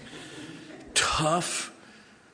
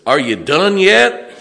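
retold loud call; 11 semitones higher, 15 dB louder, slower than usual. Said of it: "Loretta says".

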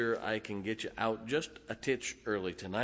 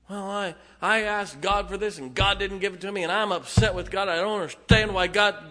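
higher, louder indignant whine; put-on aggrieved protest, pitched up; mock complaining; 6 semitones higher, 5 dB louder than usual. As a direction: imitate someone griping about being let down. Acted complainer upset with God.

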